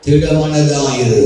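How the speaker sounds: a slow, low, gentle திடமனதாயிரு; slow tender command. Acted. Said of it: "என்ன சொல்றாரு". (மெதுவான மென்மையான கட்டளை)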